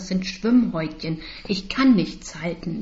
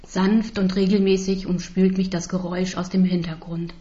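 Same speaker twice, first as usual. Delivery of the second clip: usual speaking voice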